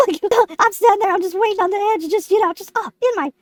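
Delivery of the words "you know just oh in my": ordinary talk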